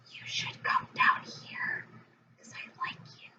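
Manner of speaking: soft breathless whisper, leaning in close; whispered invitation. Retold or imitated. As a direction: whisper it like a secret come-on. Hades secretly inviting Persephone.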